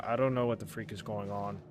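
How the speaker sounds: ordinary talk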